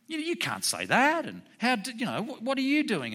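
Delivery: high-pitched mock protest; firing off. Young punk protesting to Judge Judy in court, firing off. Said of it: "he starts firing off".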